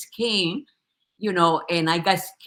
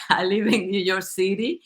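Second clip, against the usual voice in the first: usual speaking voice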